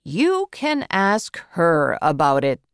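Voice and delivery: usual speaking voice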